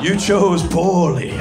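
very slow, grave pronouncement; slow solemn movie-knight line; Indiana Jones knight. Retold or imitated. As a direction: intone it like the ancient guardian. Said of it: "chose the wrong cup in Indiana Jones".

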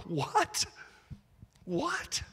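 high, stunned "What?" with a laugh; incredulous double take; astonished. Acted. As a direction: gasp it out in disbelief. Mock astonishment at the claim.

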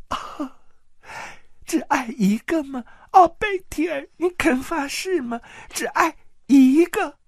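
high tearful woman's voice, bitter and accusing; a wronged wife spilling every secret through tears; tearful jealous aunt. Acted. Jealous aunt confessing her crimes to Petyr in tears. Lysa begging for his vow.

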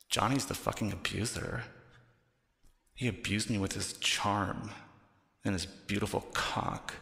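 ordinary talk, nothing marked

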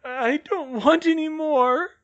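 high, slow, loud frightened whine; whimpering. A narrator's reading. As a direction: whimper it in a drawn-out high pitch. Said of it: "he whimpered".

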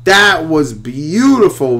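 loud, high and very slow: that was beautiful; a drawn-out gasp of admiration; awed. Real, not acted. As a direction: savour it, overwhelmed with delight. Reacting to the trailer he made.

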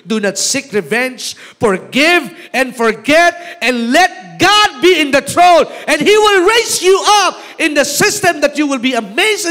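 building to a high, loud exhortation; full-force preaching. Real, not acted. Preacher urging church to forgive and forget, rising to shouted preaching.

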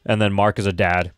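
normal talking voice

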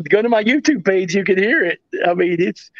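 ordinary talk, nothing marked

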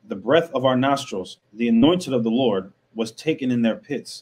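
loud, declaiming Bible verses to the room; scripture reading. Acted. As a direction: proclaim each verse like from a pulpit. Reads scripture aloud.

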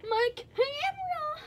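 high, slow, whining cry; whiny wail. Real, not acted. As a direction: wail about the broken camera. Camera glitch, wailing at it.

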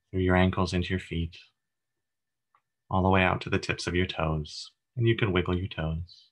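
even, unhurried meditation voice with long pauses; calm, steady body-scan narration; guided meditation. Acted. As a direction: guide listeners gently through breath and body, leaving space between phrases.

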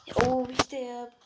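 usual speaking voice

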